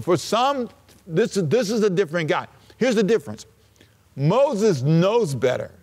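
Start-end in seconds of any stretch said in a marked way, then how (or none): none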